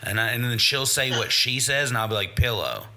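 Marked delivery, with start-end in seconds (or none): 2.4-2.8 "Pillow" dropped low, with a heavy P; too much P. Retold or imitated. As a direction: punch the P in "Pillow", pitched well down. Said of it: "you're putting too much on the P".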